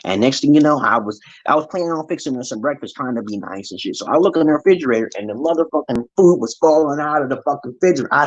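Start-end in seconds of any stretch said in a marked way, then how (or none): none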